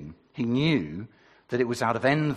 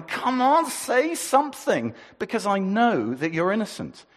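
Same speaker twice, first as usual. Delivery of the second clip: urging; 9 semitones higher, 5 dB louder than usual. Acted. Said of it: "Pilate is there going".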